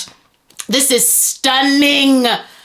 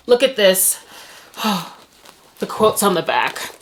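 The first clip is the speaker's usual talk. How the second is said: ordinary talk, nothing marked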